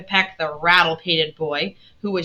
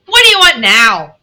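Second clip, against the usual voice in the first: loud, pitched-up impatient demand; curt Aunt March; 5 semitones higher, 13 dB louder than usual. A narrator's reading. Unwelcoming aunt greets her visitors, loud and curt.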